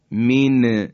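normal talking voice

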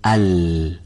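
smooth ال, neither hissed nor stopped; correct L. Shown as a demonstration. Teacher giving students the correct lām. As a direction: glide through ال. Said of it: "باید به این شکل بگیم".

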